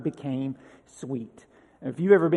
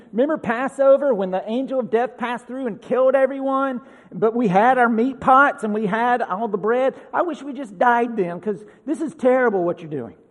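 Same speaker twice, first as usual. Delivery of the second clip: mock griping, pitched higher; grumbling complaint; 6 semitones higher, 4 dB louder than usual. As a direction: act out the crowd's complaint, raised voice.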